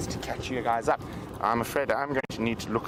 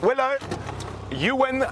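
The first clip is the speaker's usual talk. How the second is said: excited; 12 semitones higher than usual. Real, not acted. Announcing the winner excitedly.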